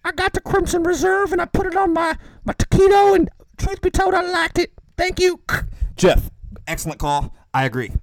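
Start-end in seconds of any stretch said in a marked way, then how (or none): 0.0-5.4 very high, squeaky phone-in caller; odd caller voice. Acted. Caller Jeff phoning a telethon, odd high-pitched voice. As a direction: put on a ridiculous falsetto for the caller. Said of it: "I don't know why I talk like this".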